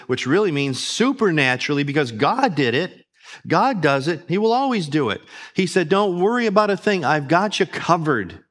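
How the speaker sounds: loud, rising preacher's fervour; full-force preaching. Acted. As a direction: build the congregation up with each line.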